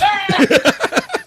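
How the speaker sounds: high, sudden laugh at himself; burst of laughter. Real, not acted. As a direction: crack up after the stumble. Laughing at his own stumbles.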